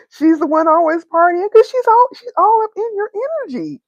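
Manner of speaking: high and loud, bursting out; excited. Real, not acted. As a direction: gleefully spill the tea. Excited gossip about a partier.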